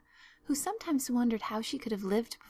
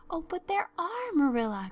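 very high, insistent protest; eager cry; 9 semitones higher, 4 dB louder than usual. A narrator's reading. Anne insists to Marilla that ghosts exist, eager. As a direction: contradict eagerly, voice leaping up. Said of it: "cried Anne eagerly".

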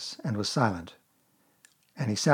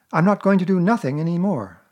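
put-on higher, brighter boy's voice asking; a small boy catching on; boyish Christopher Robin; 5 semitones higher, 5 dB louder than usual. A narrator's reading.

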